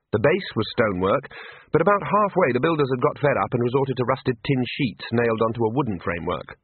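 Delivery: usual speaking voice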